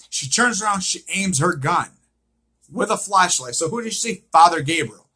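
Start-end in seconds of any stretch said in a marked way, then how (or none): none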